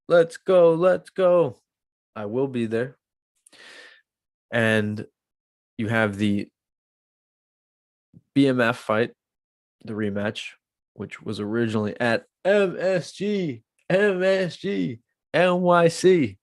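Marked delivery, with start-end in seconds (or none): 0.1-1.6 louder, higher let's go; hyped cheer. Real, not acted. Vlogger hyped about the fight card, cheering. 12.4-16.4 hyped announcement. Real, not acted.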